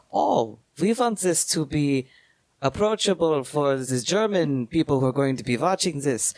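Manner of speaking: put-on German accent. Explaining how the film was localized for Germans, in a mock German accent. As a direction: play up a comic German accent, voice lifted. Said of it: "Do you not like my German accent".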